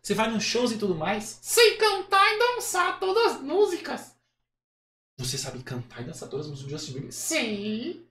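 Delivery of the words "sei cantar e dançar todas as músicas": very high, slow puppet voice; Kleber. Acted. Puppet bragging about his idol's songs, high puppet voice. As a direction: boast slowly as a squeaky dummy. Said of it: "acha uma voz que pareça com o boneco".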